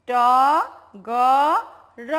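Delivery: "ট", "গ", "র" each held as a separate syllable; letter by letter. Shown as a demonstration. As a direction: sound out "ট, গ, র" with pauses between. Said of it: "প্রথম বর্ণটা কি ছিল বলো তো".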